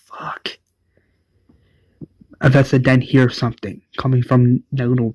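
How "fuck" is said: shocked, voiceless whisper; whispered curse. Retold or imitated. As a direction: hush it out in disbelief. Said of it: "Avesta's like".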